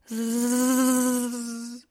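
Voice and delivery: long, even zzz hum; sustained insect drone; mosquito buzz. Acted. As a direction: buzz steadily like a circling mosquito. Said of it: "da hört er es wieder".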